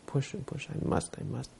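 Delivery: strained inner voice. Retold or imitated.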